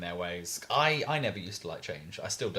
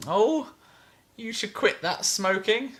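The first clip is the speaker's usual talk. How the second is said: high, louder, slow mock woman's voice; imitated woman customer; 13 semitones higher, 6 dB louder, slower than usual. New customer advising a pub regular, imitated high woman's voice. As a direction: put on a high-pitched customer offering advice. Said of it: "this woman came along".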